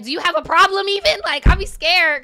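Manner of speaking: loud, high mock confrontation; aggressive Northeasterner. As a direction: shout it like picking a fight, imitating a Northeasterner. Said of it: "it just sounds so aggressive".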